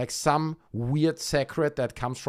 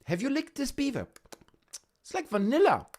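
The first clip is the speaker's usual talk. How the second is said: high, squeaky put-on voice; silly high-pitched toy voice; squeaky cartoon voice. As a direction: play a tiny cartoon critter. Playful bit about beaver toy.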